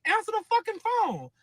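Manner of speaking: retold demand shouted at her man about the caller; furious yell. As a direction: yell it at him to pick up. Furious order during phone fight.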